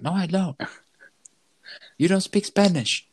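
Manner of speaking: high-pitched indignant retort; mock indignant. Mumbling supervisor telling native speakers off, mock indignant. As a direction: imitate someone hotly denying it and accusing back. Mumbler defends himself, acted.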